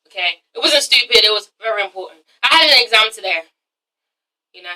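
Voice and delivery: normal talking voice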